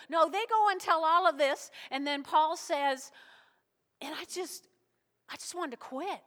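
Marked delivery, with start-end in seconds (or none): 4.0-6.2 softer, halting admission of defeat; subdued, acted discouragement; mock despondency. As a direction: voice a worn-out man quietly giving up. Voices Paul wanting to give up.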